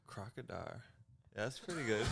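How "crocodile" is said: soft attempt at Australian "crocodile"; Australian accent. Shown as a demonstration. Guest echoing the prompt word, attempted Australian accent. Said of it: "gonna give you a gimme".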